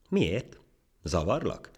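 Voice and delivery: high, wondering questions; puzzled surprise. Acted. Ant told to clear off, surprised. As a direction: ask in innocent astonishment. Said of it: "csodálkozott a hangya".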